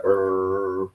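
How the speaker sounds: low mock growl, dog warning off the child. Dog on the bed growling at the child, low rumbling growl. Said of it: "the dog is like".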